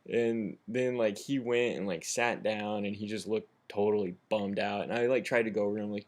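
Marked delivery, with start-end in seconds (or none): none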